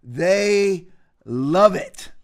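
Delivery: very slow, "They" and "love it" split by a pause; slow, deliberate repeat for effect; drawn-out emphasis. Real, not acted. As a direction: stretch "They", pause, then land "love it".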